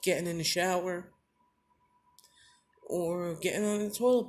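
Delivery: high, strained voice fighting back tears; choking up while listing what he lost; voice breaking. Real, not acted. To the viewers about losing his legs, voice breaking. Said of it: "I get real emotional".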